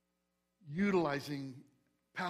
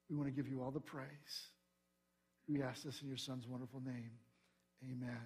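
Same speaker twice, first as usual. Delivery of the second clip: hushed, quick pastoral prayer, softer; 5 dB quieter than usual. Acted. Pastor praying before the sermon, hushed and quick. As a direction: pray quietly and quickly on behalf of the congregation.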